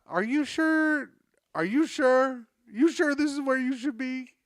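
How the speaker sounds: mock-voiced worried Uber driver; doubtful driver voice. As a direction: raise the pitch and ask like a worried driver.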